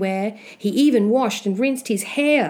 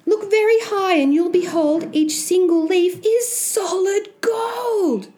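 put-on high-pitched, thrilled young Jack; excited cry; 11 semitones higher than usual. Boy showing mum the golden leaves, high excited cry. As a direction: point out the wonder, high and eager. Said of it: "cried Jack".

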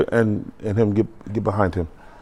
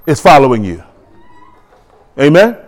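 normal talking voice

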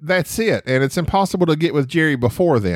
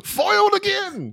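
high, slow cartoon-villain lament; hammed-up defeated villain; mock foiled villain.

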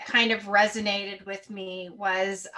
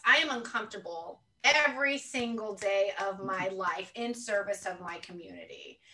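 ordinary talk, nothing marked

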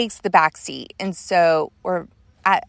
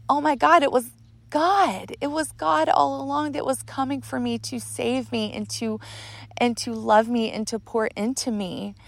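overwhelmed realization; 6 semitones higher than usual. Retold or imitated. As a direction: marvel with regret at who it was. Reliving realization God came to save.

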